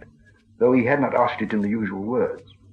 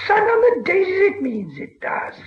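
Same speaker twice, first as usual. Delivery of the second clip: put-on whimpering creature recalling old days; Gollum voice; 3 dB louder, slower than usual. A narrator's reading. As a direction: cry out a half-lost memory, then sink. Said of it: "he said".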